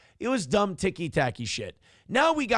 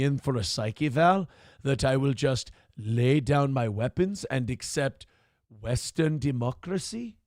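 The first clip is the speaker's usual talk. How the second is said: a lightly put-on Somali warlord accent, lower; 4 semitones lower than usual.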